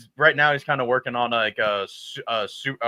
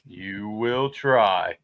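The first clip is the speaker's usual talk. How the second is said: slow menacing; 6 semitones lower, 4 dB quieter, much slower than usual. Acted. Anakin line, slow.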